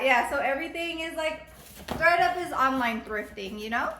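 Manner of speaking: usual speaking voice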